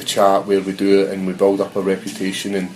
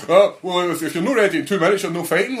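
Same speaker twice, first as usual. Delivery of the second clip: mocking official; 8 semitones higher, 4 dB louder than usual. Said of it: "those guys saying".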